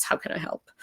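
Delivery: whispered, caring check-in; gentle whisper. Shown as a demonstration. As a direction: breathe the question softly to a friend. Demo of the right question.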